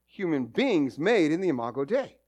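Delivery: ordinary talk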